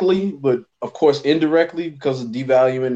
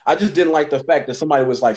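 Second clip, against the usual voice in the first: ordinary delivery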